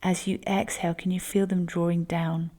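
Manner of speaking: ordinary delivery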